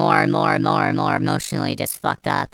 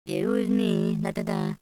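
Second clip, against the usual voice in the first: a light mock voice, higher and softer; mock confession; 3 semitones higher, 5 dB quieter than usual. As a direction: lightly play the sheepish liar. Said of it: "Shade Narts goes".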